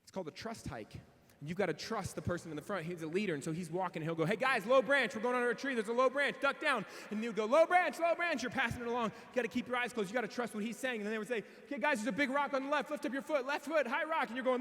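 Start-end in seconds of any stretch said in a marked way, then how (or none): 4.2-6.9 raised, higher call down the line. Retold or imitated. Trail leader calls warnings back. 7.4-8.4 high, loud relay call; passing on warning. Retold or imitated. Hikers pass the warning along. 11.6-14.3 calling out. Retold or imitated. Leader calls out next obstacle.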